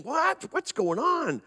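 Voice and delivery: a hint of a bewildered grandmother; startled grandma. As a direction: fluster like a surprised grandma. Grandma runs in, confused.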